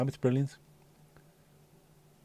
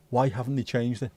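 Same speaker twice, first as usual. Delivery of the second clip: ordinary delivery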